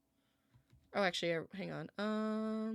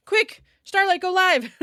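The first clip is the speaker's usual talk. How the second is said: playful shout; 11 semitones higher, 10 dB louder than usual. Pretend. Jokingly urging cat.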